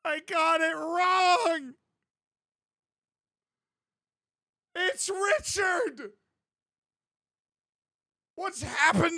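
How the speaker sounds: high, loud, stretched-out cries of despair; mock anguished shouting. Acting out the Vine for a friend, hammed-up anguish. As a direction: wail it like a man losing his mind. Reenacting the Richard Vine outburst.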